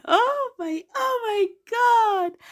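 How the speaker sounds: hammed-up heartbroken cry; mock anguished wail. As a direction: wail it like your heart just broke. Reacting to crush's words.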